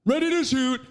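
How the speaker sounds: high, goofy imitation of himself; hammed-up clueless excitement; mock eager novice. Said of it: "I was just like".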